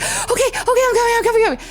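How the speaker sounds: high, breathless-sounding mock panic; frantic assurance. Traveler told to get here now, high frantic babble. Retold answer while running in panic.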